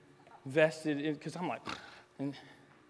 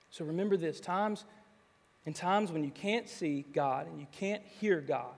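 ordinary talk, nothing marked

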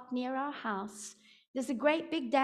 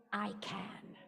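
quiet affirmation; 6 semitones lower, 10 dB quieter than usual. Shown as a demonstration. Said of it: "begin to say".

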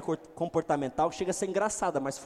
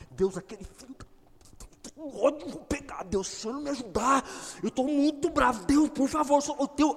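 a much higher, furious, strained plea to God; angry prayer; 10 semitones higher, 5 dB louder than usual. Acted. Believer praying to God while furious, high and strained. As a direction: pray through clenched rage. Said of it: "Se você nunca orou com raiva".

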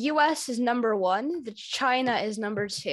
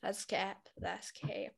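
softer, fading echo; quiet; 2 semitones lower, 12 dB quieter, slower than usual. Acted. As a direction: murmur it back, dropping away. Echoing friend, fading quieter.

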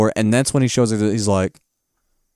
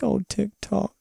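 tender; 10 semitones higher, 6 dB quieter, slower than usual. Retold or imitated. Dorothy's tender moment.

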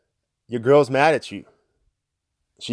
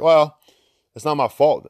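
mock defensive, blame pushed away, louder at first; defensive excuse; 4 semitones higher, 5 dB louder than usual. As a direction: play the leader dodging the blame. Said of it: "I could have been like".

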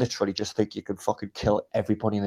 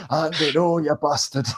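put-on Darlington accent; 4 semitones higher than usual. Mate pleading to Andy, bad Darlington accent. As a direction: botch a Darlington accent on purpose. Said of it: "that's Yorkshire".

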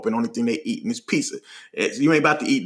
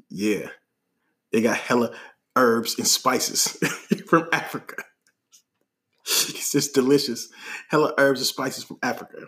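deadpan boast; 3 semitones lower, 4 dB quieter than usual. Retold or imitated. Friend touting pizza to hungry stranger, low deadpan. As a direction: imitate a friend solemnly overselling food, laugh slipping in. Imitates Ike describing the pizza.

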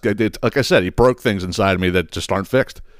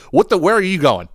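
mock outraged question; 9 semitones higher than usual. Son mocking the secretary's excuse, high incredulous squawk. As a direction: act out catching her lying.

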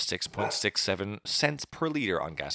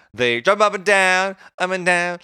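bouncy sing-song; 9 semitones higher, 7 dB louder than usual. Pretend. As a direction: let the voice bounce up and down on the words. Mocking bouncing prices.

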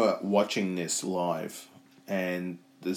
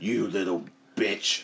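slow, drawn-out mock threat; measured, hammed-up contempt; mock menace; 2 semitones lower, 3 dB quieter, slower than usual. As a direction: say it slowly, word by word, as a glowering husband. Voicing husband's angry look.

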